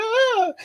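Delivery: high-pitched comic shriek of fright; drawn-out high "ah"; mock scream. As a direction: imitate a scream at a shark fin. Imitating fright at fin like Jaws.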